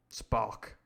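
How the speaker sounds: ordinary delivery, nothing marked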